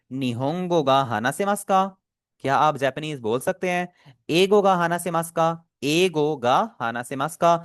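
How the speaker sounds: slow, clear model reading; slow repeat. Shown as a demonstration. Teacher repeats the phrases slowly for students. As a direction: read each phrase slowly for the class. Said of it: "मैं ये pronunciation आपके सामने repeat कर लेता हूँ".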